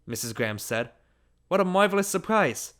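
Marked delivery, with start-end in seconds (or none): 1.5-2.7 higher-pitched hostess voice; delighted hostess. A narrator's reading. Mrs. Graham welcomes an unexpected guest, delighted. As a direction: greet a guest with delighted surprise.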